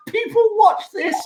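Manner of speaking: very high, gleeful taunt; mock cackling Randy. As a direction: cackle like a villain counting cash. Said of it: "just laughing and going".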